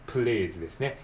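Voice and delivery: normal talking voice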